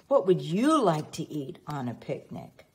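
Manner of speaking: ordinary delivery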